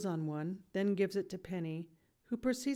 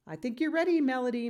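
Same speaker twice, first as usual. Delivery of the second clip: higher, louder proud announcement; teacher proudly announcing the student is ready; 6 semitones higher, 7 dB louder, faster than usual. A narrator's reading. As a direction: declare it with pride. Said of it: "Miss V announces".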